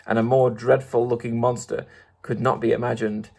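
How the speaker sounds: plain reading voice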